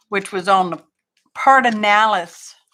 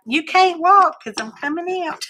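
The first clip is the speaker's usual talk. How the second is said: put-on baby voice; 9 semitones higher than usual. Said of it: "The baby's like".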